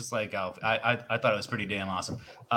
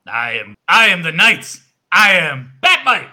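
loud, high mock-heroic proclamation; grandiose hero shout; 7 semitones higher, 12 dB louder than usual. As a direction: declare yourself like a caped superhero. Grandiose self-introduction in character.

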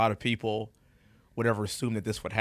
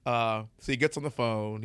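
ordinary talk

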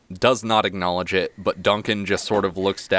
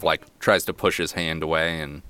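normal talking voice

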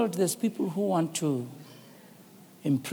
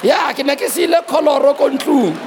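louder and higher, calling out to the hall; excited shout; 9 semitones higher, 10 dB louder than usual. Real, not acted. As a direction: belt it to the congregation. Preacher exclaims to congregation.

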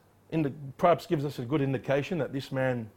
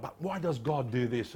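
normal talking voice

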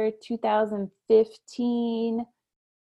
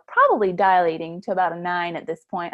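ordinary delivery

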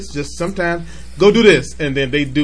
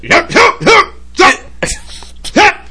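very high, loud repeated yelps; mock dad yelping; 5 dB louder, much slower than usual. Dad calling his only two boys, mock yelping. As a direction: fire off high, loud single-word yells. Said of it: "I was yelling at my boys".

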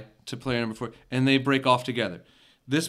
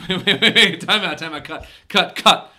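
raised, rising pleas to stop; acted panic; 5 semitones higher, 8 dB louder than usual. Interviewer facing a shotgun, acted panic. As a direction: build from nervous laugh to loud cries.

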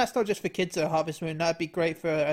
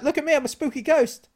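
higher, louder spooky ghost voice; unearthly voice; 9 semitones higher, 5 dB louder than usual. Retold or imitated. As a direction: moan it like a cartoon ghost. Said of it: "and I was like".

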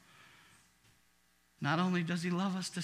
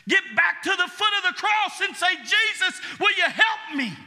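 impassioned preaching; 11 semitones higher, 7 dB louder than usual. Acted. Preaching at full force.

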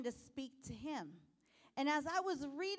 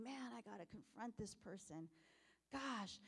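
much softer, dreading the confrontation; reluctant mutter; 4 semitones lower, 13 dB quieter than usual. Retold or imitated. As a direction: say it under your breath, dreading it. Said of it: "we should enter into that conversation knowing".

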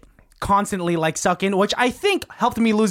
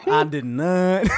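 Bill Clinton impression; slower than usual. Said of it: "Bill Borat over here".

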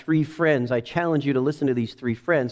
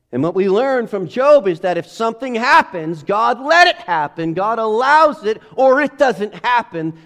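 higher, louder fervent preaching; preaching fervently; 7 semitones higher, 6 dB louder than usual. Real, not acted. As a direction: drive the point home with fire. Preaching at full force.

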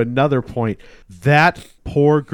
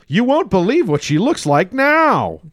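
mock clickbait; 14 semitones higher, 7 dB louder than usual. Mock clickbait headline read out.

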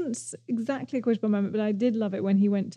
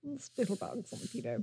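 much softer, murmured repeat of the quote; quiet echo; 11 dB quieter than usual. Retold or imitated. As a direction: echo it under your breath.

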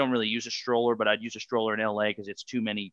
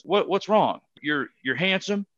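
hyped-up dad; 6 semitones higher than usual. Retold or imitated. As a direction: pump up your down son like a proud Army Ranger dad. Dad pumping up his son.

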